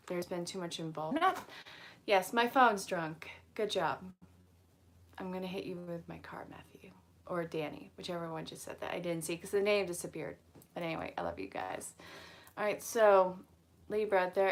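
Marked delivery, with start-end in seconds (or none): 5.2-8.9 playful whispered threat; hushed mock menace.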